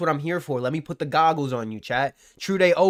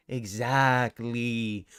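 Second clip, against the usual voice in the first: smug drawl; 6 semitones lower, 7 dB quieter, much slower than usual. Real, not acted. Drawn-out smug agreement.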